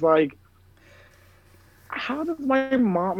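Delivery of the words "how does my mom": high, slow mock disbelief; retold bafflement. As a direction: draw out the baffled question up high. Acted bafflement about her mother.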